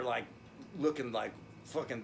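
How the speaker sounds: ordinary talk, nothing marked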